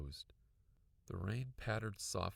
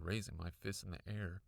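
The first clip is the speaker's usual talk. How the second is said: plain reading voice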